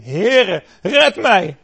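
high, loud cry of a sinking man; desperate cry for help. Acted. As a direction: yell for rescue as you go under. Peter sinking cries for help.

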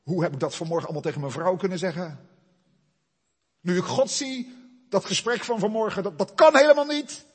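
horrified realisation. Pretend. Isaiah realises his unholy talk with his wife, crying out. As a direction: panic building as guilt dawns. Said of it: "ineens is hij zich bewust".

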